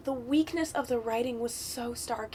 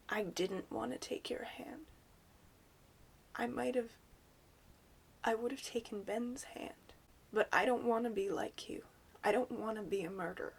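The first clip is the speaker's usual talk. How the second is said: whispering; 8 dB quieter than usual. Acted. Rey's shocked quiet line.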